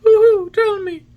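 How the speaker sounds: very high, loud, drawn-out pigeon call. A narrator's reading. Pigeon starts questioning the little boy, in pigeon voice. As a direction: coo it out high and slowly. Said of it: "said the pigeon".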